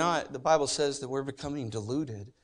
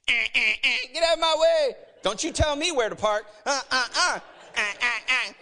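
loud, high honks and snapping; mock road rage; 9 semitones higher, 4 dB louder than usual. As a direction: imitate an impatient driver honking and yelling. Churchgoers raging in the parking lot.

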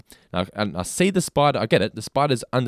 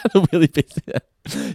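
high, loud, drawn-out teasing echo; amused mimicry; 6 semitones higher, 4 dB louder, much slower than usual. Echoing co-host's Aussie word, amused mimicry. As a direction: stretch it out, mocking the phrase.

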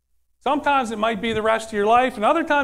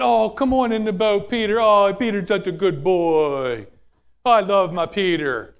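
mock coddling. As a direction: coo over him like an indulged little boy. Mock coddling of Peter by Jesus.

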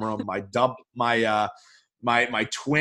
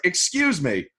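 raised, pointed complaint; indignant complaint; 6 semitones higher, 4 dB louder than usual. Retold or imitated. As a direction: call out the skimpy guac. Confronting the counter guy.

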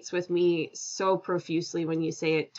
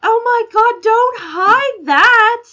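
excited plea; 14 semitones higher, 12 dB louder, slower than usual. Real, not acted. Guest hears host is from Belfast.

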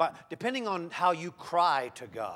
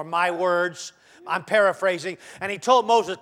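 ordinary talk

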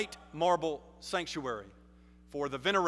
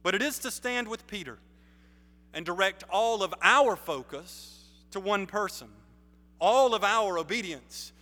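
building louder and higher, each "all" hit; preaching climax; 4 semitones higher, 5 dB louder than usual. Real, not acted. Preacher rallying the congregation, building to full force. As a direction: rise to a full-voiced call, stressing every "all".

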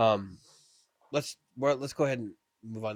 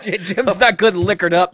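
laughing; 8 semitones higher, 13 dB louder, slower than usual. Real, not acted. Co-host teasing through laughter.

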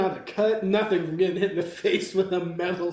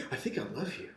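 very soft, lower lovestruck murmur; smitten mock confession; 6 semitones lower, 14 dB quieter, faster than usual. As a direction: murmur it as if awestruck, jokingly. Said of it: "and he's like".

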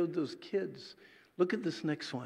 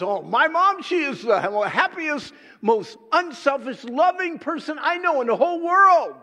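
much higher and louder, a lightly voiced admiring girl; gushing daughter; 10 semitones higher, 11 dB louder than usual. Preacher imitating a daughter praising mom, excited. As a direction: gush about mom like a proud daughter. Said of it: "your daughter says".

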